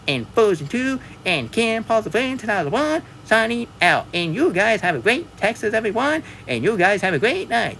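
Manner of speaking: high, hammed-up Texan cowgirl voice, yeehaw and all; high-pitched mock Texas accent, playful; Sandy Cheeks voice. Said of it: "I'm very sorry I sound like Sandy Cheek".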